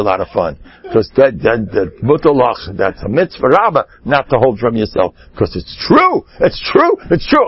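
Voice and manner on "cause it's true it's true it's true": high, rising insistence; a hammed-up high protest that it is true; mock insistence.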